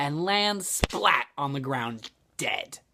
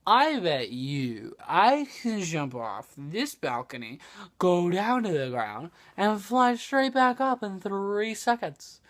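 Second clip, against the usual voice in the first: lightly voiced drunken boast, rising higher; mildly hammed-up bar bragging; boastful drunk; 5 semitones higher, 3 dB louder than usual. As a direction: stretch out the bet, a touch tipsy. Drunk guy makes a boastful bet.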